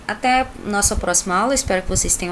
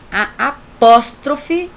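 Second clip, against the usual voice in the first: "apóstrofe" drawn out slowly, loud and raised; emphatic apóstrofe; 5 semitones higher, 8 dB louder, much slower than usual. Shown as a demonstration. Teacher giving the strong-sounding term its weight, slowly. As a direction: stretch "a apóstrofe" with force. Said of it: "por isso tem esse nome forte".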